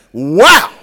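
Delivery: loud mock amazed wow; a hammed-up gasp of wow.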